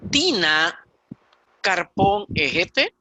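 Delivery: "Tina Carpón Ejete" dicho despacio, palabra por palabra; dictado lento. Shown as a demonstration. (ejemplo leído lentamente)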